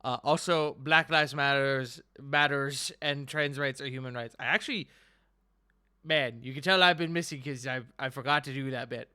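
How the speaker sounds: ordinary talk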